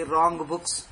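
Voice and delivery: ordinary delivery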